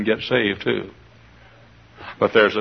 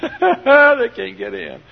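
laughing; 12 semitones higher, 5 dB louder, much slower than usual. Acted. Gleeful, safe inside the city.

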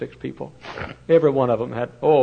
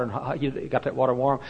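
usual speaking voice

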